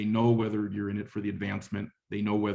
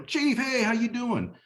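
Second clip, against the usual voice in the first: much higher, bouncy hello to the boss; excited greeting; 14 semitones higher than usual. Retold or imitated. A sergeant happily greeting his visiting chief, excited and high. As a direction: greet him like you're thrilled he came.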